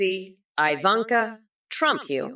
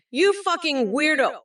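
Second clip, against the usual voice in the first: angry insult; 7 semitones higher than usual. Acted. Insulting Trump in rant.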